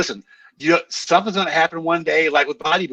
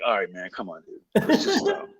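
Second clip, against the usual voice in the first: lower, lazy imitated bro; mock bro voice; 7 semitones lower, 5 dB quieter than usual. Mimics bodybuilders' casual plea.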